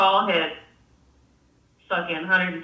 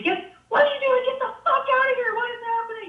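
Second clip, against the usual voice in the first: retold screaming; 14 semitones higher, faster than usual. Two guys screaming at a camper to get out, mock high-pitched scream. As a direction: imitate frantic strangers screaming at you. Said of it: "they're just screaming".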